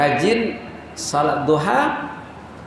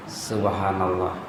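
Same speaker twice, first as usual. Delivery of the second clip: hushed awe; 10 semitones lower, 9 dB quieter, slower than usual. Real, not acted. Awed exclamation.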